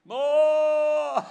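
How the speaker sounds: long, high, booming call of a name; hugely drawn-out theatrical holler; dramatized booming God. Acted. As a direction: thunder it out like God, vowel drawn out. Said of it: "I'm gonna dramatize it a little bit".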